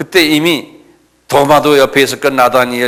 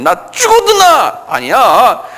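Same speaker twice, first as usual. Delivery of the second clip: high, loud, desperate-to-convince yelling; shouted denial; 4 dB louder, slower than usual. Acted. Peter swearing to bystanders he isn't one, shouting. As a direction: shout him down and disown the man. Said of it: "막 이러면서 소리 지르면서 하는 거예요".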